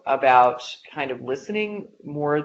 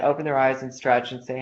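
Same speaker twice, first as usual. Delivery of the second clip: ordinary talk, nothing marked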